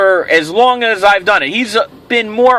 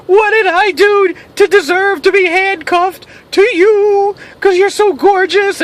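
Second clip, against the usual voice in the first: awed high voice; 9 semitones higher than usual. Acted. Asks the gorgeous woman.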